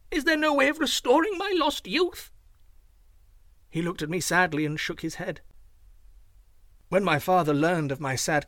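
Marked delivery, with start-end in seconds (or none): 0.1-2.3 much higher voice, crying out in despair; a young man's high, despairing cry; cried out in despair. A narrator's reading. Young man pleads his lost youth, high despairing cry. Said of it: "I cried".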